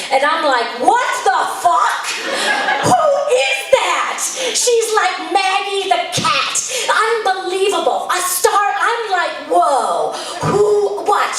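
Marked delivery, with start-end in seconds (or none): 0.8-4.2 a parent floored by his daughter; stunned. Real, not acted. 5.3-8.7 gushing. Retold or imitated. Woman gushes over daughter. 9.5-11.4 amazed. Real, not acted. Amazed at daughter.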